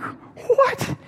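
high, breathy stunned gasp of a word; stunned old man. Retold or imitated. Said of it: "it's like".

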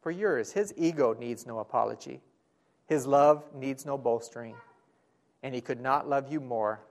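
ordinary delivery

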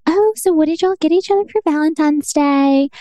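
high, sing-out mom voice; imitated mom. Mom asking the couple over Valentine's dinner, imitated chirpy mom. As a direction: imitate a Southern mom asking, pitched well up. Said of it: "my mom is like".

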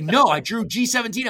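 high and loud, thrilled; friend's news, voiced high and eager; excited reply. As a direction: blurt it out, buzzing.

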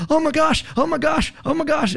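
put-on panicked old woman. Neighbour in her robe panicking at the fire, high frantic squeal.

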